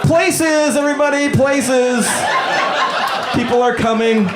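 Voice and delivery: rally the cast like a director; stage director calling. Pretend.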